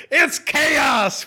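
high, shouted exasperation; exasperated. Real, not acted. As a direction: throw up your hands and cry chaos. Exasperated about talking baseball in January.

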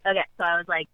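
ordinary delivery

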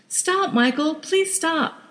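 high, begging plea; pleading. Acted. Wife begging her husband to stop, high pleading. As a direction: beg him to stop the car.

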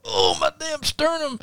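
high, quiet, slow whimper of pain; mock pained groan. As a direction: whine it like you just took a punch to the chest. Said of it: "Kenny going".

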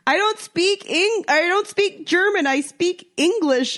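high, slow flustered insisting; flustered protest. Retold or imitated. Tourist telling the German she only speaks English, high and flustered. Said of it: "just like".

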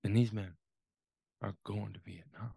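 low and hushed, with a heavy pause; grave father. Retold or imitated. Father telling son where players are headed, low and grave. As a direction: let the voice sink softly toward the end. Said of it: "he kindly regarded me and said".

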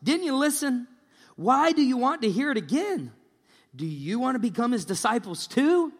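high, loud, exasperated challenge; exclaiming. A narrator's reading. Healed man challenges those questioning him, exclaiming. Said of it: "the man exclaimed".

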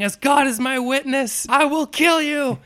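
high, hammed-up vengeful scream; Chris Farley screaming. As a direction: imitate a raging man swearing an oath. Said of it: "Chris Farley is screaming".

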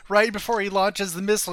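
usual speaking voice

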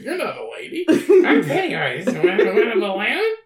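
high, laughing imitation of the voices; hammed-up retold clown lines; lightly voiced mock Pennywise and leprechaun; mocking retelling. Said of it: "Cody just didn't tell a very good story".

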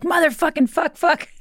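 put-on high, sudden burst of kid swearing; mock kid outburst, high-pitched; kid cursing. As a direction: blurt it out like a small boy. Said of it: "all of a sudden he's like".

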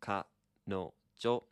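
kanojo stretched very slowly with rising end; slow model. Shown as a demonstration. Teacher modelling kanojo slowly for learners. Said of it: "So you can say kanojo".

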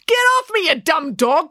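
high, loud exasperated yell; angry cry. A narrator's reading. Officer fights off the biting dog, angry cry. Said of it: "cried Officer Odinson".

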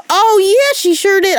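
high-pitched, emphatic concession; sudden realization. Real, not acted. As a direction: jump up high as it dawns on you. Suddenly recalls, concedes the point.

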